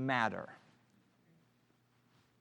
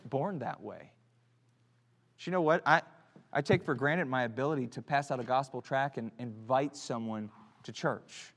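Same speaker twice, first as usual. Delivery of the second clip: ordinary talk, nothing marked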